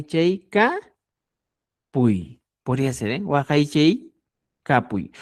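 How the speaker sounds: ordinary talk, nothing marked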